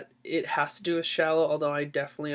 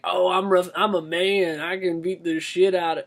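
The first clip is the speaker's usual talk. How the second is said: mock tough guy; 4 dB louder than usual. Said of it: "[name] would be like".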